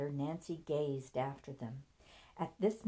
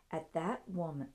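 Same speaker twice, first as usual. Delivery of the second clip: plain reading voice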